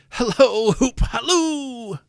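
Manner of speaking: high, loud, drawn-out holler; exuberant hallooing. A narrator's reading. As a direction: whoop it out to someone running off. Scrooge hallooing after Friday.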